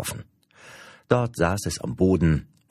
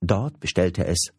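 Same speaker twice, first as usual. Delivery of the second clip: usual speaking voice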